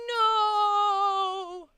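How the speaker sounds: loud, drawn-out high "no"; mock dismay. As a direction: wail it in mock despair. Dismay at needing Southern accent.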